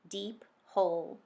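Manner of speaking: ordinary delivery, nothing marked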